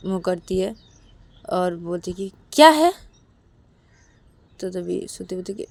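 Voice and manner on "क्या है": sharp, high, loud snap; irritated retort. A narrator's reading. Siya turning to Shruti who keeps calling, irritated. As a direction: snap back at someone pestering you. Ignored friend snaps back at caller.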